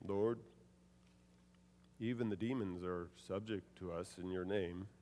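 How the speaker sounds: low, soft, subdued; flat, quiet. Shown as a demonstration. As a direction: say it flat and hushed, without joy. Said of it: "I can't imagine them saying".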